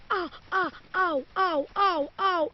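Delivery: high yelps of stinging pain; pained ow cries. Real, not acted. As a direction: cry out ow as fingers sting. Numb fingers stinging.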